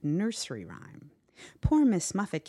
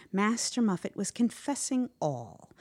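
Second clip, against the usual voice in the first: ordinary delivery, nothing marked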